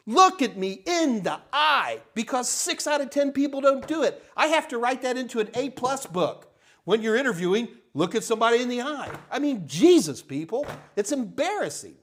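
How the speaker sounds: high-pitched, forceful soapbox rant; passionate, emphatic scolding; vehement. Real, not acted. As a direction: drive the advice home with exasperation. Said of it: "I'm just vehement".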